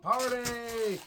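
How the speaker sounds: high party whoop; rowdy party cheer. Pretend. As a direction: yell it like a frat party. Party chant take.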